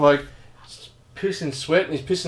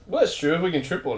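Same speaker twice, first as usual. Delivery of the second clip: ordinary talk, nothing marked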